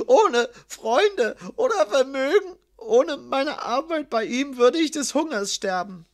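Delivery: high, whimpering, pleading account; sobbing. A narrator's reading. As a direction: whine like a beaten dog while confessing. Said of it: "schluchzte Promero". (schluchzend und winselnd, mit hoher Stimme)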